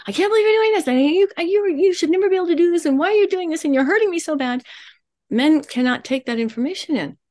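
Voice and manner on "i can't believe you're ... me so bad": much higher, fast, upset torrent of words; rapid fire talking. Shown as a demonstration. Counsellor mimicking a wife's rapid fire complaint, high and fast. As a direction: fire it all off at once, high and frantic. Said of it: "furrow my eyebrows and start rapid fire talking".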